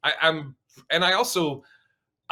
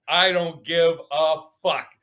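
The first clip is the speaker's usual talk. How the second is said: slow, drawn-out and louder; slower defiant repeat; 2 semitones higher, 4 dB louder, slower than usual. Retold or imitated. Repeating it to the crowd, slow and louder. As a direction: lean into each word the second time. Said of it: "I literally go".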